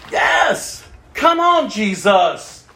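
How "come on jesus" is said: ordinary delivery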